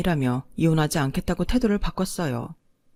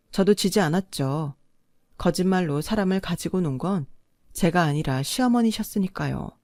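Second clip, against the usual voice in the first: ordinary delivery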